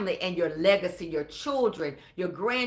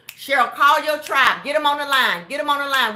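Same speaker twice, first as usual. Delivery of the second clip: loud urging; 4 semitones higher, 9 dB louder than usual. Real, not acted. Urging a viewer to invite people.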